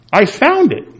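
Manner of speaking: high mock yell of discovery; retold yell. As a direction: call it out like you just struck gold. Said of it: "a woman standing maybe five feet away from me yells".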